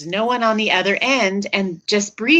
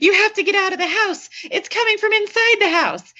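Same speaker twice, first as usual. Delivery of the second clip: urgent warning; 11 semitones higher than usual. Retold or imitated. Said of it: "he says".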